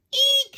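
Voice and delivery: high startled shriek; sudden panicked eek; shrill eek. A narrator's reading. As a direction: squeal in alarm like a mother bird. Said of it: "she cried".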